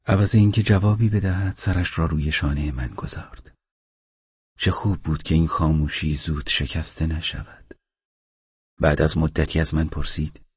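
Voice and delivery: ordinary delivery, nothing marked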